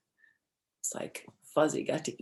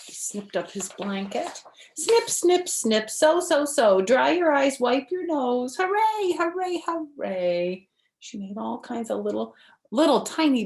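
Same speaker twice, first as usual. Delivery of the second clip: bright, lively storybook read-aloud; reading to children; 10 semitones higher, 9 dB louder than usual. Acted. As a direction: read it like story time for little kids. Reads picture book to children.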